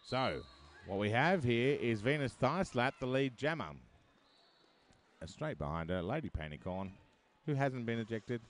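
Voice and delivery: loud, driving roller derby call; live play-by-play. Real, not acted.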